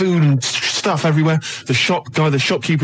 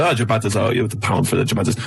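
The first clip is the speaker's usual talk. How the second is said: low, badly faked Italian accent; botched Italian accent; 6 semitones lower than usual. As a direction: imitate the Italian customer in a muddled low accent. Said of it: "getting the hint from the ac".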